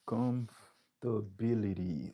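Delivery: "Comfortability" said slowly, every syllable spaced out; slow model word. Shown as a demonstration. Teacher models the student's word slowly for comparison. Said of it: "mais j'en prononce là".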